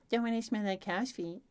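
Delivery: high-pitched mocking echo. A narrator's reading. Boy rolling his eyes at Elaine, mocking. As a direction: parrot it back in a silly high voice. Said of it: "in your best mocking voice say".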